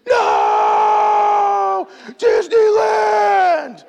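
put-on high, loud drawn-out wail of a little kid; child screaming. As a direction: scream it like a three-year-old being hauled away. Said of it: "just hearing screams".

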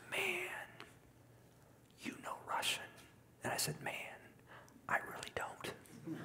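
hushed whisper, barely voiced. Friend marvels quietly at Russian, whispered. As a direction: breathe it like a secret.

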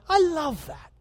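light up with joy on the words; a fond father's delighted exclaim; delighted approval. Retold or imitated. God delighting in cheerful giving.

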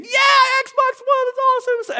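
high-pitched re-enacted Christmas whoop; elated teen cheer. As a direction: imitate a son thrilled by his gift. Son's joy on opening the gift.

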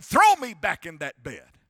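loud mock yell, punched at the start; retold yell. Patient begging the therapist after standing, retold yell. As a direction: imitate a pained patient yelling out. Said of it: "I screamed, I yelled, I said".

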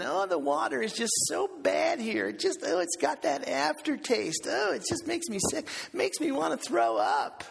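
high-pitched mock whining; hammed-up griping in a high voice; whiny complaining. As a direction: moan like a pampered citizen. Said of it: "were always complaining".

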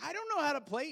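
high, loud alarmed protest; panicked protest. Retold or imitated. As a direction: blurt it out in rising panic. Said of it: "I was like".

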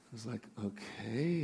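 very soft, low, wary murmur; hesitant reply. Acted. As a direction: answer quietly and unsure. Man hesitantly answers the bird.

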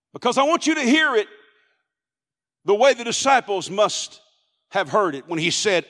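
ordinary talk

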